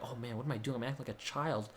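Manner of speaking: very soft, low self-reproach; quiet inner groan at acting childish; muttered to himself. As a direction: say it under your breath, embarrassed at yourself. Scolding himself inwardly.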